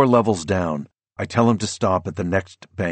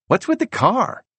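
much higher, puzzled son; teen boy voice. A narrator's reading. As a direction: voice the son, confused. Teenage son surprised at dad.

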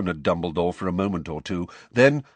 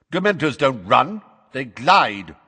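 raised, loud, pointed correction; sharp correction; 6 semitones higher, 6 dB louder than usual. Acted. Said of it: "said Madam Bones sharply".

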